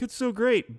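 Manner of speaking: high, gushing praise; gushing fan. Retold or imitated. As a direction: gush like a superfan. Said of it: "other people are like".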